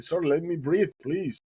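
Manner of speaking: retold pleading. Exhausted swimmer to boatman, pleading.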